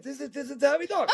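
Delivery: laughing impression of an elderly relative; Uncle Leo. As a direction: imitate a great uncle's way of talking. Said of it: "who talked like this".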